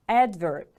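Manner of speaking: "Advert" punched on AD; first-syllable stress. Shown as a demonstration. Teacher models the word for repetition, "Advert" stressed firmly on AD. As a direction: hit the start of "Advert" firmly: AD-vert. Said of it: "The stress is on the first syllable".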